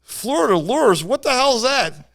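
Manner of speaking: a mild mock of a confused old man; lightly voiced baffled guide. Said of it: "And the guy goes".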